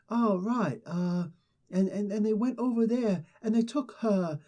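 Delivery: lightly hammed British accent; Natalie Portman's British accent. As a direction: imitate her shaky British accent, hammed up. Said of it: "you could do an accent".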